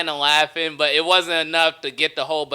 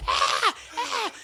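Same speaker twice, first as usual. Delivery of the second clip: gasping laugh; 5 dB quieter than usual. Retold or imitated. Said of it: "Everyone's just like".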